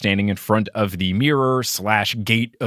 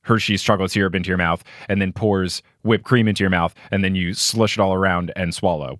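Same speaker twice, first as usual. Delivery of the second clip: usual speaking voice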